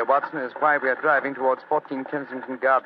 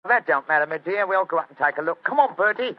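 high, loud Cockney workman; Cockney accent; 6 semitones higher, 6 dB louder than usual. Acted. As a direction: bluff Cockney, chatty and loud. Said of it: "My Cockney accent doesn't compare with yours".

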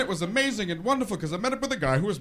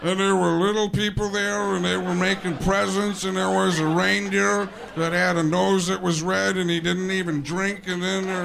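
rambling sports-commentator impression, running on without a break; John Madden. Commentator still talking about Christmas specials, rambling on. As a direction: keep rambling in one steady, insistent voice. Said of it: "John Madden still talking".

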